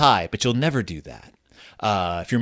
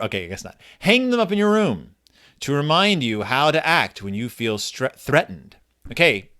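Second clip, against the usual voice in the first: normal talking voice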